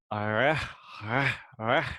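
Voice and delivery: "reh" with the r made far back in the mouth; back-of-mouth resh. Shown as a demonstration. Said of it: "historically pronounced at the back".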